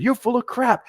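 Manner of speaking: high, scornful retort; retold boss scorn. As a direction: dismiss him with contempt. Colonel dismissing his excuse.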